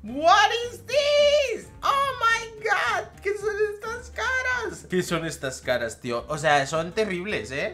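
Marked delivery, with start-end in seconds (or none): 0.0-4.8 shouted high in disbelief. Real, not acted. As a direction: yell it out, baffled. Repeats the question louder.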